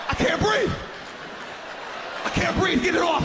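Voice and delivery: hammed-up suffocating child; panicked kid. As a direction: beg to get the towel off. Kid under towel panicking.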